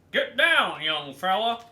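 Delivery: stern order. Acted. Trainer orders boy.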